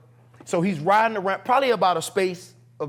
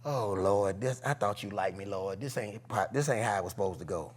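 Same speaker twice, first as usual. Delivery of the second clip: low, hushed plea to God; retold dismay, soft and low; despairing prayer; 9 semitones lower, 10 dB quieter than usual. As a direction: lament quietly to yourself. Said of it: "and I, and I said".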